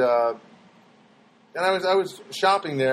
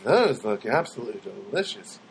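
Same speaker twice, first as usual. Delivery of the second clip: creepy stranger; 6 semitones lower than usual. Retold or imitated. Imitating the weird Hot Bar stranger who praised his olives, low and creepy. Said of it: "he didn't start off by going".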